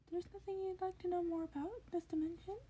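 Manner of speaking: high, curious creature voice; eager high questioning; curious Nezu. A narrator's reading. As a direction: probe him like a keen little principal.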